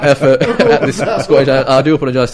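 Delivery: giggly self-mocking outburst; laughing. Real, not acted. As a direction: laugh at your own bad attempt. Laughing at his own failed accent.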